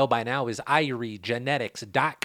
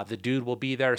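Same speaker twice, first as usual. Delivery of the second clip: ordinary talk, nothing marked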